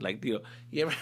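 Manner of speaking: ordinary talk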